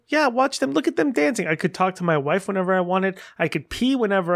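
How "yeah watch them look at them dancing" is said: delighted high exclaim. Retold or imitated. Recalling joy at the movie.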